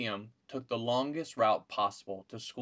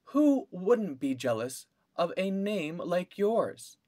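soothing mother, high, louder, slow; 7 semitones higher, 5 dB louder, slower than usual. A narrator's reading. Mother comforting daughter, high and slow. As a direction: reassure her child as mother. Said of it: "said her mother".

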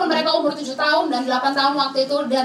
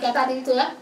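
ordinary delivery

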